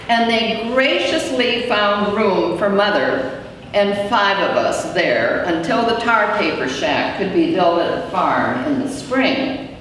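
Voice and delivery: ordinary delivery, nothing marked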